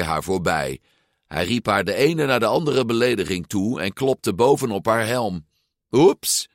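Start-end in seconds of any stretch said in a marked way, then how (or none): 5.9-6.5 high, louder fake oops; mocking. A narrator's reading. Rival taps her helmet, a high mocking oops. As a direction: feign an accident, pitched up. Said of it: "zei hij spottend".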